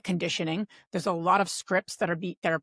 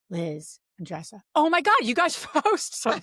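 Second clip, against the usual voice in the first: retold realization; 5 semitones higher than usual. Conference woman realizing she's talking to the hosts, laughing surprise. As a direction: imitate the conference woman suddenly realizing who they are. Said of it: "She goes".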